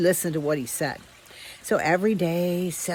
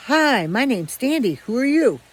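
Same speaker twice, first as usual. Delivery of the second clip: cheery greeting; 6 semitones higher, 5 dB louder than usual. Retold or imitated. Friendly dog greeting.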